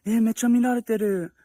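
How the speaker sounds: high-pitched mimicked girlfriend voice; imitated girlfriend. Girlfriend telling him she's being stared at, imitated high voice. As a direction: imitate her uneasy complaint in a high voice. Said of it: "and she was like".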